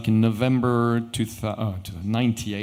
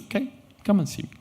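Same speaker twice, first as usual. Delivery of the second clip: higher, quicker friendly invitation; lightly voiced Majid; 11 semitones higher, faster than usual. Majid inviting the watchmaker to Dubai, quick high friendly voice. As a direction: toss off the invite brightly and fast.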